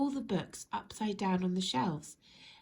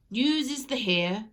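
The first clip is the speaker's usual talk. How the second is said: news reader; 3 semitones higher, 8 dB louder than usual. Acted. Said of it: "said the television reader".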